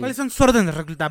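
loud barking drill sergeant. Acted. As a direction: bark orders at a recruit. Interrogating the pigeon.

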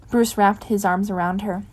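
ordinary delivery, nothing marked

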